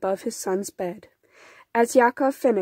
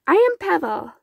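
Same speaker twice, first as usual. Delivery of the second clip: put-on young boy; 9 semitones higher, 4 dB louder than usual. A narrator's reading. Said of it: "said the boy with big green eyes".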